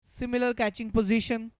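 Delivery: normal talking voice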